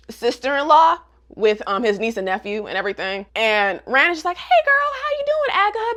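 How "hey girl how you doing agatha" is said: very high-pitched, gushing hello; excited greeting. Retold or imitated. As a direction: play an excited sister-in-law greeting her. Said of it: "So she's, like, excited".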